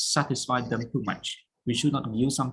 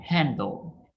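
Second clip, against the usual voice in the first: handle with HAND stressed. Shown as a demonstration. Teacher modelling for students, stress on hand. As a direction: hit the first syllable of handle. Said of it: "stress on hand".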